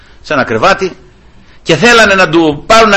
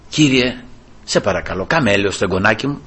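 murmured prayer; 6 semitones lower, 6 dB quieter than usual. Retold or imitated. Retold quiet prayer.

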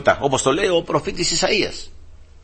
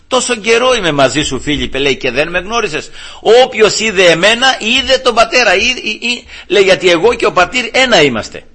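high, forceful scripture quote; preaching proclamation; 7 semitones higher, 4 dB louder than usual. Acted. Christ answers Philip, voiced in emphatic preaching. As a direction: declare it with mounting conviction.

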